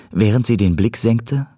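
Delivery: ordinary delivery, nothing marked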